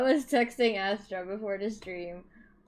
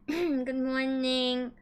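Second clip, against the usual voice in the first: cute anime streamer; 4 semitones higher, much slower than usual. Acted. Slow cute greeting.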